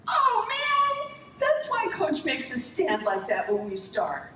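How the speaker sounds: put-on high, amazed kid voice that slows down at the end; a boy suddenly getting it; kid's realization. A boy watching the World Cup suddenly understands his coach, high and amazed. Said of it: "one of the kids goes".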